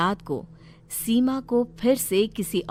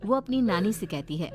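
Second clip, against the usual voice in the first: ordinary delivery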